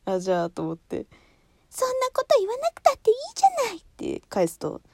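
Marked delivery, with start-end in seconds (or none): 1.7-3.8 very high, fast cutesy Minnie whine. Retold or imitated. Daughter answering her mother in a Minnie voice. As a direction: pout back sweetly as Minnie. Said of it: "ミニーちゃんの真似".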